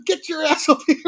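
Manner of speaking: mock dad yell. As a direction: call the kid back like an angry dad. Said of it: "his dad screaming out at him".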